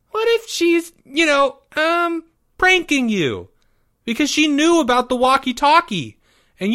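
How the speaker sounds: put-on very high, squeaky kid voice; anxious young boy. A narrator's reading. Boy warning his friend of a prank, squeaky and anxious. Timmy anxiously warns Leo.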